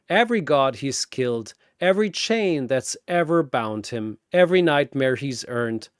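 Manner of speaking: ordinary delivery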